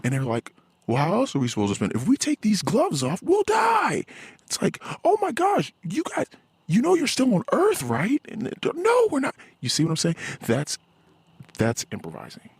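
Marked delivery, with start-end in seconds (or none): 0.9-4.0 high-pitched, squealing mock alarm; mock panic. Mimicking panicked people in retold quote. 5.0-8.2 high-pitched incredulous retort; mock exasperation. Acted exasperated reply to them. 8.7-9.3 high-pitched imitation of alarmed people; mock panicked denial. Mimicking their panicked denial.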